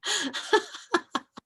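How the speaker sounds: a gentle, friendly laugh; kind gentle laugh. Real, not acted. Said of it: "Sorry, I'm not laughing at you".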